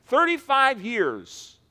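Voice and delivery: ordinary delivery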